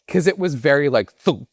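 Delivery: normal talking voice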